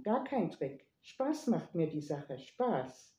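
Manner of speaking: ordinary delivery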